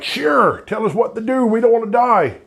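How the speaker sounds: a high, scared plea from sailors; scared sailors pleading. Acted. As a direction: beg to be told what to do.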